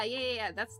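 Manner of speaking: ordinary talk, nothing marked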